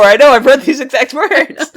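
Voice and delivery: loud, high, slowed-down laughing; laughing protest. Real, not acted. Co-host teasing a friend's repeated rant, laughing loudly. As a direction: crack up while protesting.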